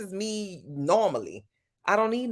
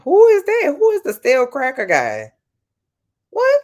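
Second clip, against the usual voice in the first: a sudden high, loud outburst of who; can't believe she's never heard of him; shocked disbelief; 9 semitones higher, 9 dB louder than usual. Real, not acted. To her chat about the cracker guy, shocked and loud. Stunned by an unknown name.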